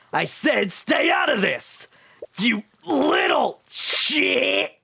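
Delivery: slow, high shouted threat; angry shout. Acted. Teen shouting at Tyrion to back off. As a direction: yell it out like a thug. Reading the angry character's line.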